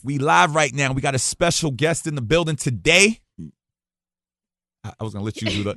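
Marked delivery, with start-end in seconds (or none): 0.0-3.2 higher, loud announcer-style host intro; high-energy on-air host opening; radio show intro. Acted. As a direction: open the show like a hip-hop radio DJ.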